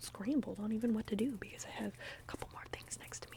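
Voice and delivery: usual speaking voice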